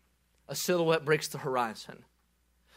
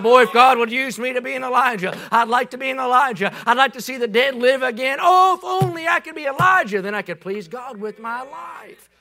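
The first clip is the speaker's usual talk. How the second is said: loud high preaching cry; fervent longing; 6 semitones higher, 8 dB louder than usual. Retold or imitated. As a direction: plead it out loud. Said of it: "And I said".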